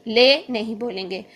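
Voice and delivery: ordinary talk, nothing marked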